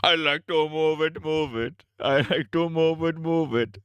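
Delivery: high, slow cartoon king chanting; King Julian. Cartoon king doing his catchphrase, high Julian impression. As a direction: chant it in a put-on cartoon accent. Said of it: "What if King Julian was".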